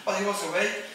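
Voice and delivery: ordinary talk, nothing marked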